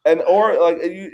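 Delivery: ordinary delivery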